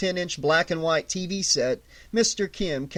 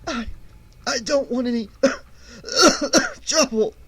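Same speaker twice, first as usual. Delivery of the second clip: higher, cracking and coughing plea; pleading; 8 semitones higher than usual. A narrator's reading. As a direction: beg through lost breath. Winded Arlen pleading.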